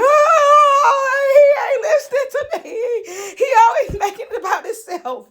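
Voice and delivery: hammed-up bawling with laughter in it; mock crying. Daughter complaining after hanging up on dad, mock crying. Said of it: "I was still crying".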